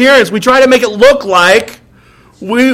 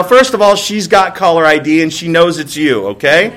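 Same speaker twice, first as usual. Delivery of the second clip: usual speaking voice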